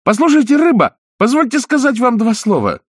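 high, loud calling out; shouting. A narrator's reading. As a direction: call out across the water. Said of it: "Пиноккио закричал".

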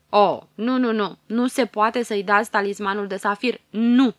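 a loud, outraged no; loud refusal. A narrator's reading. Tiger fairy to the queen, a loud outraged refusal. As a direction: bang out every no against the gift.

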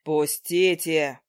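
low, slow, drawn-out demand; mildly irritated low call; insistent, irritated man. A narrator's reading. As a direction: insist in a lightly voiced, irritated male voice. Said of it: "Настойчиво раздражённый голос".

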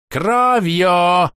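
loud, high furious shout; screamed. A narrator's reading. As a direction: scream the word out. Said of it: "возопил Кастиэль".

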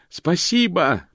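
high shout over the roar. A narrator's reading. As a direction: yell over the flames. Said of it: "прокричал Мокрец сквозь рёв". (крик сквозь рёв пламени)

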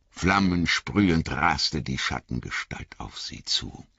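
plain reading voice

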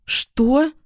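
high, incredulous outburst; turning angry. A narrator's reading. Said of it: "становится злым". (возмущённо, высоким голосом)

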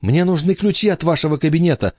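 rushed and fast; quick nervous boy's blurt. A narrator's reading. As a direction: blurt it out nervously in one breath. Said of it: "выпалил Майкл".

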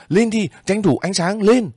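very high, loud shout to move. A narrator's reading. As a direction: bark the order over the noise. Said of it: "những tiếng quát".

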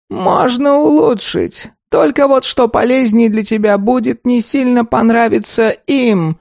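high, slow, grumbling golem; a scolding creaky tree creature. A narrator's reading. Golem gives verdict.

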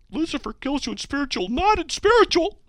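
high-pitched incredulous read-out; mocking contradiction. Host mocks a self-refuting line from hate mail, high incredulous voice. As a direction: pitch it way up, loud on the flip.

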